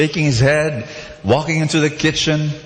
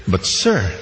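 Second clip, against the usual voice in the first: dry calm waiter; 2 semitones lower, much slower than usual. Acted. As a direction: point out the obvious deadpan. Waiter replies calmly.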